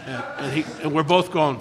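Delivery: ordinary talk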